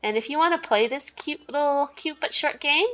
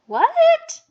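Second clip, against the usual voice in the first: high loud startled squeak; shocked what; 10 dB louder than usual. Real, not acted. Realizing the stranger sent his number, shocked. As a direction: jolt of disbelief.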